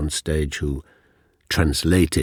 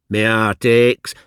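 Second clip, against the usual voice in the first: schoolboy reading Keats in broad Yorkshire-Cockney, higher and louder; 7 semitones higher, 8 dB louder than usual. Retold or imitated. Pupil reading aloud to his teacher in Cockney. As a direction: speak it in your own working-class accent. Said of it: "Forwards only of".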